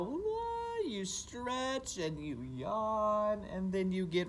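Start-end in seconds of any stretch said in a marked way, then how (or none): 0.0-0.8 sudden high yelp; a big high waking yelp; waking cry. Shown as a demonstration. Teacher showing children how to wake and stretch, high yelp. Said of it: "you wake up and you go".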